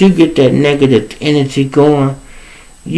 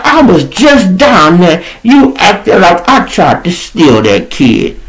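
indignant retold rant, higher pitched; re-enacted angry protest; snapping; 7 semitones higher than usual. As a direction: throw the accusation back at her. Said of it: "I snapped, y'all".

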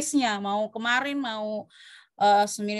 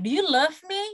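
higher-pitched, lightly acted question; partner's question; 6 semitones higher, 3 dB louder than usual. Partner asking their lover for an answer, lightly acted question. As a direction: ask it as a worried-for-love partner, lightly. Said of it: "misalnya kamu lagi ditanya".

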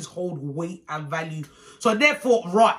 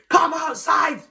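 raised, high challenge; acted angry shout; 7 semitones higher, 4 dB louder, slower than usual. Esther challenges auntie while being held back, angry shout. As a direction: call her out to fight, voice up.

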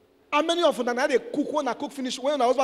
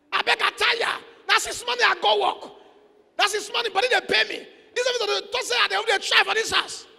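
higher, whiny excuse voice; mock lazy wife; 9 semitones higher than usual. Preacher mocking wife who refuses to cook, whiny. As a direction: whine like a wife dodging the kitchen.

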